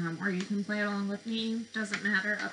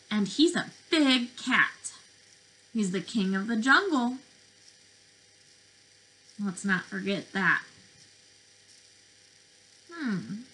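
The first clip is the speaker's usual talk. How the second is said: put-on lively, sing-song picture-book voice for little kids; reading to children; 5 dB louder than usual. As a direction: read it like circle time, drawing them in.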